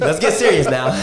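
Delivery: cracking up while saying it; laughing. Real, not acted. Jokes after immigration remark.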